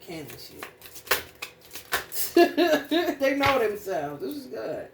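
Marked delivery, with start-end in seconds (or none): 1.7-3.1 loud laugh. Real, not acted. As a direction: burst of real laughter. Laughing at narcissists.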